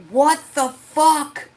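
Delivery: slow, high, loud "what the fuck"; finding the soda case empty; outraged. Retold or imitated. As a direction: drag out the shock and anger. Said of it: "And I'm like".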